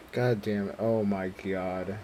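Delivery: usual speaking voice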